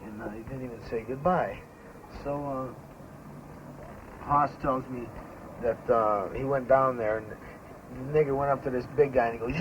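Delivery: ordinary talk, nothing marked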